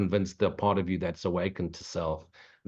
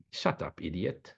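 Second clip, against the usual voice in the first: hushed put-down; 4 semitones lower, 8 dB quieter than usual. Acted. Telling a critic off.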